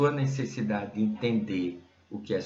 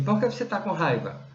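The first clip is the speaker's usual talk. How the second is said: wife's question; 6 semitones higher than usual. Retold or imitated. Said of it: "Ela dizia assim".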